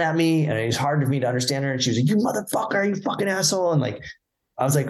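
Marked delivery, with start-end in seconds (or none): 2.1-3.7 mild mock yell at a stranger. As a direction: mimic a stranger cursing you out, high and mild. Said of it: "this woman just starts yelling".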